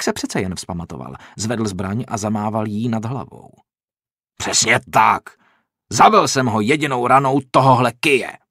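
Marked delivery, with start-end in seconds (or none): 4.4-8.4 loud, furious boast; loud angry insistence; angry snarl. A narrator's reading. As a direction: spit it out in defiance. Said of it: "zasyčel".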